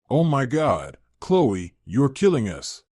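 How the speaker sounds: usual speaking voice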